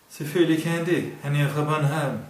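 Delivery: put-on Bornholm accent. A narrator's reading. Kurt boasts to the Jutlanders in Bornholm accent. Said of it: "bornholmske accent".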